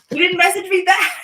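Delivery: high, loud put-on complaint; mock outrage. Complaining that Mark ignored a message, mock outrage. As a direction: pitch it way up in playful protest.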